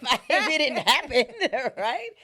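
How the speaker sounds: loud, high burst through laughter; laughing while talking. Real, not acted. Joking to the host about not withering without a husband, laughing. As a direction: crack up mid-sentence, pitch and volume jumping. Cracks up at own exaggeration.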